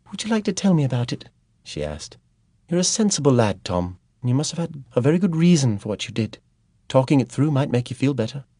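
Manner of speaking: coaxing Ellie. A narrator's reading. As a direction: coax him to open up. Ellie gently coaxing Tom.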